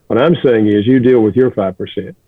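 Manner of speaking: ordinary talk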